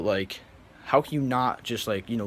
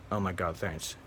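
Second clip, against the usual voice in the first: mock him with a soft, flat, mumbled thanks; quiet, indifferent throwaway thanks; an ungrateful kid barely bothering to say thanks; unbothered mumbled thanks; 5 semitones lower, 8 dB quieter than usual. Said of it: "and you're just like".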